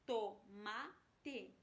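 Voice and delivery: "Tomate" broken into to, ma, te; syllable by syllable. Shown as a demonstration. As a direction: count out "Tomate" in three separated syllables. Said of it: "tem três sílabas".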